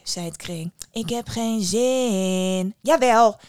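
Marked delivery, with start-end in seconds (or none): 0.9-2.8 slow, drawn-out whine; sulky refusal. Acted. Daughter refuses to go into the well, sulky drawl. 2.8-3.4 loud, insistent retort; mother exclaiming. Acted. Mother cries out to her unwilling daughter, loud insistence. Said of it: "riep de moeder uit".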